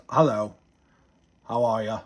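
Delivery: half-hearted stab at a South African accent; bad South African accent. Shown as a demonstration. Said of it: "kind of a South African one".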